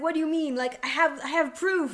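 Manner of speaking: high, loud, insisting on proof; indignant protest. Retold or imitated. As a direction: protest you've got proof. Huxley insisting.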